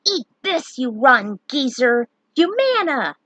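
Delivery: loud, high battle cry; defiant spell shout. Acted. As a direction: hurl the spell at the troll. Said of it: "Luneth chanted".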